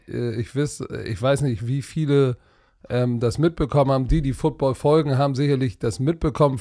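usual speaking voice

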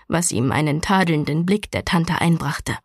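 ordinary delivery

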